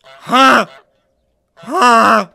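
loud nasal-free honking yelps, imitating a goose; goose scream. Streamer imitates geese screaming for his chat, loud goose honks. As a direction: honk like an angry goose. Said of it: "Geese scream I R L".

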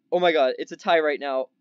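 usual speaking voice

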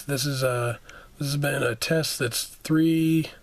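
ordinary talk

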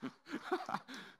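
big laugh at the punchline; hearty laugh. Real, not acted. Pastor laughing at the joke's punchline, hearty laugh. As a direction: laugh out at your own joke.

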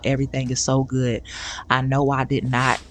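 ordinary talk

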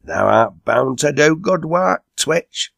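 high, hearty praise; crying out. A narrator's reading. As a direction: cheer a mate on with rising pitch. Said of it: "cried Enoch".

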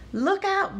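high, urgent warning call; shouted warning. A narrator's reading. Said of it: "shouted Frog".

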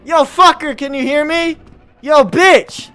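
cocky taunt. Real, not acted. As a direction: provoke the monster. Taunting the monster.

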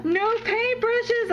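high, slow gasp of surprise; gasp, then savor the words; delighted gasp. Real, not acted. Finding paintbrushes in the gift box, gasping with surprise.

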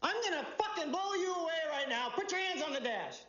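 high-pitched, forceful police threat; acted shouting, pitch thrown way up; retold scream. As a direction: yell it like a cop losing patience mid-story. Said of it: "And I scream at him".

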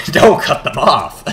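can barely get it out for laughing; real laughter breaking the line. Real, not acted. Laughing through retold line.

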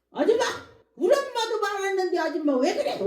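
high, loud protest; indignant child talking back. Retold or imitated. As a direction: imitate a child loudly objecting to a grown-up. Child talks back to woman.

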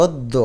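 অদ্য as "od-do", the d doubled; doubled consonant. Shown as a demonstration. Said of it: "দয় জফলাকে দুইবার উচ্চারণ করবে". (অদ্য, দ দুইবার উচ্চারণে)

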